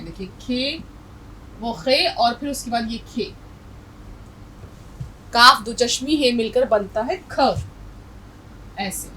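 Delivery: ordinary delivery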